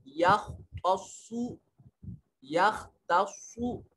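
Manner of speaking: यख्तस्सु slowly, खा and स्वाद thick and full; heavy kha and swad. Shown as a demonstration. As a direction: chant यख्तस्सु twice, heavy letters. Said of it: "खा को मोटा पढ़ेंगे". (यख्तस्सु में खा और स्वाद मोटे)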